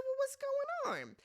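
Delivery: high-pitched sassy quote; high mock exasperation. Retelling her reaction to the show, high sassy voice.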